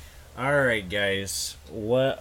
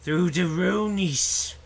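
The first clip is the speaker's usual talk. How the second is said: goblin voice; 5 semitones higher than usual. Acted. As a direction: speak like an eager goblin. Goblin explains the runes.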